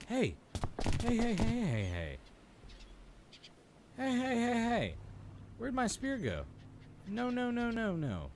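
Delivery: high-pitched frantic hey hey; frantic calling. Real, not acted. Gamer yelling at a shark taking the spear, frantic. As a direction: yelp after the thief in panic.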